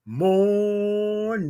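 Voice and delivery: long stretched "Morning"; drawn-out morning. Shown as a demonstration. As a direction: lean into a slow morning. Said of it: "You gotta say it".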